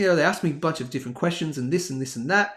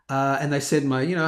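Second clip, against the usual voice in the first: usual speaking voice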